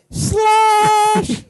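very high sudden squeak; squeaky yelp. Acted. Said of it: "you tilt your head".

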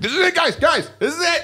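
high frantic mock panic yell; hammed-up doomsday cry to the congregation; end of the world panic. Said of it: "every Sunday you're like".

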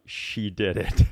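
ordinary talk

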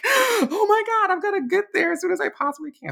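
imitated panicking mother, high-pitched and loud; frantic mom voice, acted out. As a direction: squeal it in mock panic, rushing at the end. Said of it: "frantic until I told her".

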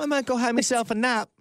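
high, hammed-up Australian; bad Australian accent. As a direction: do a cheerfully bad Australian impression. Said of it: "How bad are these accents".

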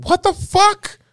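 very high, loud squeal of disbelief; amazed outburst. Retold or imitated. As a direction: burst out incredulous, voice leaping way up. Reliving amazement at Transworld.

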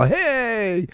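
high, squealing hey; gushing fan hello. Retold or imitated. Husband mocks a fan greeting Tom Cruise, high and gushing. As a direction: gush like a starstruck fan.

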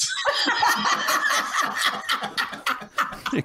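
airy, wheezing laugh; real high cackle fading; long high breathy laugh. Real, not acted. Laughs after settling the argument.

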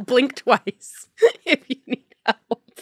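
1 laughing tease. Pretend. Teasing a tamed boyfriend.

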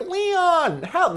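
high falsetto mock plea; imitating a girl begging for rescue; high pleading cry. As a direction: wail it way up, helpless and whiny. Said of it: "she would be like".

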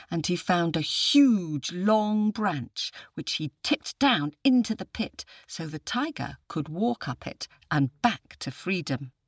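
usual speaking voice

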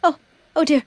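high, faltering, desperate little voice; pleading, dying candle. Acted. Candle begging the person who lit her, high frantic pleading. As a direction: beg haltingly as if about to be snuffed out. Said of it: "a candle trying to warn the person".

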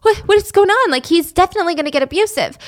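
imitating a woman panicking at the door; mock-terrified girlfriend hearing banging; terrified panic. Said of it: "So she's terrified".

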